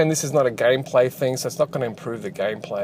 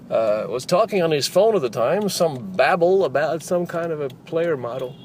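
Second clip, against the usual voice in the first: newsreader voice; 3 semitones higher than usual. Shown as a demonstration. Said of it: "that's my newsreader voice".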